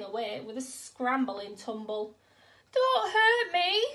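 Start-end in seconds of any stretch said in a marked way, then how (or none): 2.7-3.9 high, loud and slow pleading whimper; a big lion begging the mouse not to hurt him; frightened whimper. Acted. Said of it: "he whimpered".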